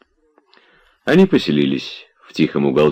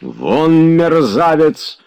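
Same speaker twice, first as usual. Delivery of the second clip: enraged shout; 10 semitones higher, much slower than usual. A narrator's reading. Oblomov throws the scoundrel out, shouting in fury. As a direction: order him out in a rage. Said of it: "закричал Обломов, бледный, трясясь от ярости".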